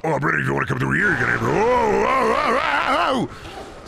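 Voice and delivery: rising startled oh-oh cries; panicked rising yelps; jump scared. Real, not acted. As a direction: get caught by a jump scare mid-sentence. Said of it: "got a little jump scared".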